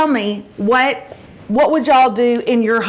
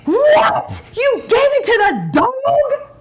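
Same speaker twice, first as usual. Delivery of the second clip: high, laughing disbelief, much higher; shocked daughter; 13 semitones higher, slower than usual. Retold or imitated. Daughter learning mom gave her tenders to the dog, laughing shock.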